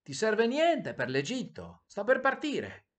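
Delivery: high, loud, drawn-out shout; singsong farewell holler; calling out. A narrator's reading. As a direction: call up to someone as you leave. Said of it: "la rondine gridò al principe".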